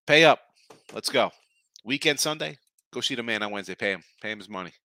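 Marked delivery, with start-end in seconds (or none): 0.1-0.4 higher, much louder pay up; escalated shouted pay up; shouted demand. Real, not acted. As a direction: shout it the second time.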